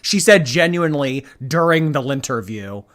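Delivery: ordinary talk, nothing marked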